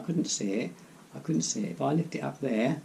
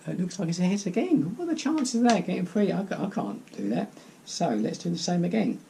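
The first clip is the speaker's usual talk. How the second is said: excited; 7 semitones higher than usual. Real, not acted. Heads again, amazed.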